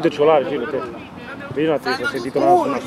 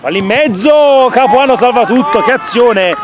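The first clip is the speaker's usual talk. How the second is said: breathless italian goal commentary; 9 semitones higher, 7 dB louder than usual. Acted. Live save commentary.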